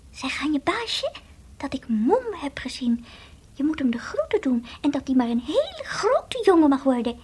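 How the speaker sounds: whispered little voice from far off; tiny faraway voice. Acted. The little voice bringing greetings from Moem, whispered. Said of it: "zei het stemmetje".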